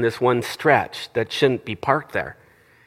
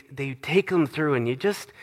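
ordinary delivery

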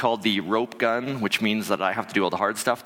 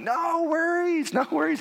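high, slow, sing-song no worries; breezy reassurance; slower than usual. Retold or imitated. Telling worried partner it's fine, high sing-song breeze. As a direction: toss it off, way up high and drawn out. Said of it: "I'm like".

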